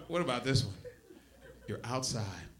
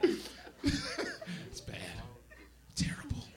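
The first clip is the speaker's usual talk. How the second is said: whispered aside; 12 semitones higher, 15 dB quieter, much slower than usual. Acted.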